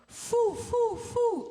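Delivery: high, loud hooting "foo"; airy owl-like vocal exercise; hooty owl sound. Shown as a demonstration. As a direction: hoot it out like an owl. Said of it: "make it hooty like an owl".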